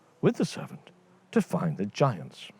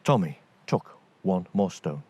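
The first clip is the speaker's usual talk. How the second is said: ordinary delivery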